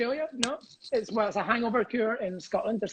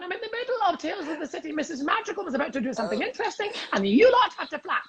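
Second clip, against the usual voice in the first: mock scolding; 9 semitones higher than usual. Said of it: "Shouting up to the pigeons, going".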